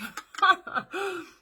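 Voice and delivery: a burst of high, loud laughter. Real, not acted. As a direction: laugh out loud at your own joke. Laughs at her own joke.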